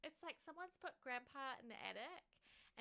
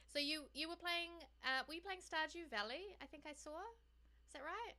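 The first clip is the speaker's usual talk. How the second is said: normal talking voice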